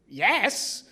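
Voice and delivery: a loud, high, instant yes; bursting yes; eager yes. Retold or imitated. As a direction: jump at the offer.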